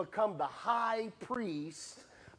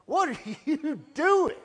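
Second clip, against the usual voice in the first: high, loud, calling from heaven; booming voice of God; 10 semitones higher, 6 dB louder than usual. Retold or imitated. God calling out to Saul, high and loud. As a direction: boom it out like God halting Saul. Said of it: "heard that same voice".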